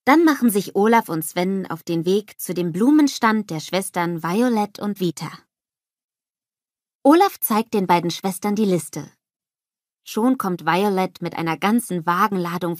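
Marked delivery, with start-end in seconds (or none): none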